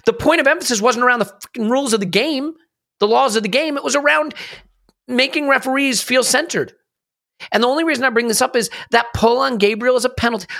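heated rant. Real, not acted. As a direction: let the frustration spill over. Heated rant about referee priorities.

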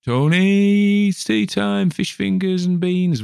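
high, loud mimicked mum calling out; mum calling for tea. Mother calls her boy in from playing outside, high calling voice. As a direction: call him in for tea like mum used to. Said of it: "my mum would call me for tea".

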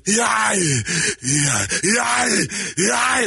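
a wrecked Schwarzenegger grunt; mangled Arnold. Pretend. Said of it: "Arnold Schwarzenegger after a bad car accident".

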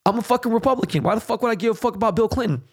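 can't believe what people are saying; fired-up, high-pitched rant; incredulous outburst. Real, not acted. Heated rant dismissing Bill Clinton.

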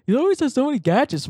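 1 mock friend. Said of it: "They're like".

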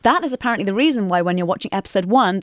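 ordinary talk, nothing marked

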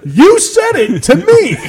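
drawn-out mock accusation; retorting guy. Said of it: "He goes".

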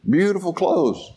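normal talking voice

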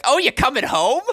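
high mock mom voice, loud; high, loud imitation of mom. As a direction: play his mom hearing the news. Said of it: "And she'll be like".

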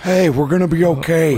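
exaggerated high reassuring voice; Nicolas Cage. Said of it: "Fucking Nicolas Cage on him".

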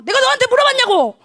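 high, shouted rebuke; imitated angry outburst; acted scolding. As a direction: snap loudly like an exasperated pastor. Acting out the pastor's scolding.

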